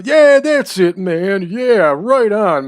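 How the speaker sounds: high, loud imitation of drunken delight; excited drunk guy. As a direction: act out a drunk's thrilled recognition. Drunk guy finally hears the answer.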